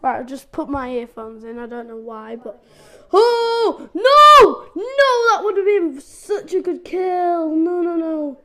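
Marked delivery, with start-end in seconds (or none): 3.1-7.5 much louder, much higher cry of dismay; anguished shout. Real, not acted. Losing a big kill live. 7.6-8.4 rapid loud repeated no's, then hope; panic, then grab at the chance; louder panicked no's. Real, not acted. Panicking mid-chase.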